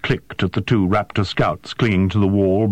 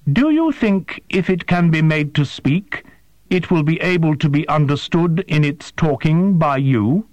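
higher, stiff and formal alien voice; alien interpreter; 8 semitones higher than usual. A narrator's reading. Interpreter questions the human, high and formal. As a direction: ask with precise, careful, unhuman politeness.

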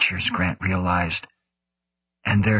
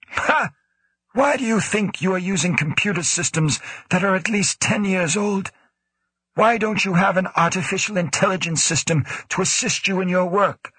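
put-on raised, sneering old director voice; scornful director; 11 semitones higher than usual. A narrator's reading. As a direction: needle him with a higher, scornful voice. Woe questions Grant scornfully.

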